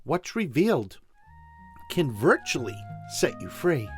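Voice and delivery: normal talking voice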